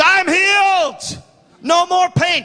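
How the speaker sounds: high, drawn-out cry of joy; jubilant shout. Retold or imitated. Woman announcing that she's healed, jubilant shout. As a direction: shout the news out high and joyful.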